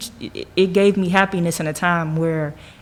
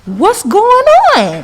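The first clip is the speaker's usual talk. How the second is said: high, slow, baffled "what's going on"; mock bewilderment; 10 dB louder, slower than usual. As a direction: act stunned and lost. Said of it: "And I'm just like".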